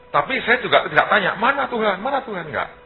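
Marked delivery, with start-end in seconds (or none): 1.4-2.5 pitched up, pleading where is God; a high, mocking where-is-God whine; mock impatient plea.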